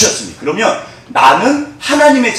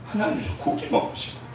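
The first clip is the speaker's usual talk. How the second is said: unvoiced, much softer plea; whispered prayer; 9 semitones higher, 11 dB quieter than usual. Trainee whispering her prayer for meat to God. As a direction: breathe it out as a secret wish to God.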